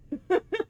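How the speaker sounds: breathless laugh. Real, not acted.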